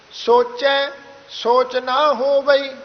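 slow recitation with a break after ਸੋਚੈ; pause after ਸੋਚੈ. Shown as a demonstration. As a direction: hold ਸੋਚੈ, pause, then join ਸੋਚ ਨਾ ਹੋਵਈ. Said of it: "ਸੋਚੈ ਤੋਂ ਬਾਅਦ ਵਿਸ਼ਰਾਮ ਲੱਗੇਗਾ ਜੀ". (ਸੋਚੈ ਤੋਂ ਬਾਅਦ ਵਿਸ਼ਰਾਮ)

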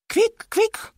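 very high, airy little squeals; pig squeal. A narrator's reading. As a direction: squeak it like a piglet. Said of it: "kwiczał jak prawdziwa świnia".

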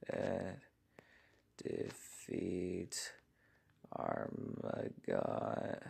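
slow, soft, half-whispered answer; a hushed, solemn declaration. Acted. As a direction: draw out each word softly, savouring it. Slowly spells out his answer.

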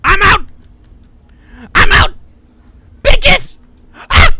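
high, very loud bursts; frantic shouting. Real, not acted. Player making an escape from Santa, frantic shouting. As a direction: yell each burst at full pitch.

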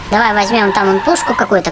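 ordinary talk

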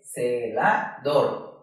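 "Celador" slow and low, syllable by syllable; dividida por sílabas. Shown as a demonstration. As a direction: separate ce, la, dor in "Celador". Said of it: "La dividimos en tres sílabas". ("Celador" despacio, sílaba por sílaba)

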